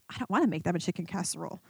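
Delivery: low, soft, quick grumble; sulky refusal. Retold or imitated. Balking at God telling her to cook, low sulky grumble. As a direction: grumble it under your breath.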